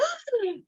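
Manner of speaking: sharp high gasp of shock; a quick offended intake of breath; startled gasp. Retold or imitated. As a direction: gasp like you've been insulted. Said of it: "Damone went".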